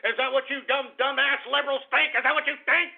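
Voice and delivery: high-pitched, indignant demand; angry challenge. Real, not acted. Host challenging liberals he blames for violence, angry challenge. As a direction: throw the question at them in outrage.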